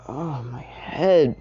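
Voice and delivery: slow, drawn-out dizzy moan; woozy and dragging; dizzy groan. Acted. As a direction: groan it slowly, head spinning. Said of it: "he was dizzy".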